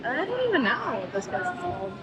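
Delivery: ordinary talk, nothing marked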